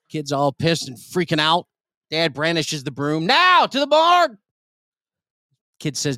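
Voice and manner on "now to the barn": high, loud barked order; mock command. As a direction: drive the kid out to the barn. Dad orders son with broom.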